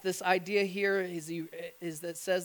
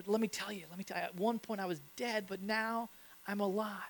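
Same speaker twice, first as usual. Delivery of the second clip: hushed quick testimony; 7 dB quieter, faster than usual. Retold or imitated.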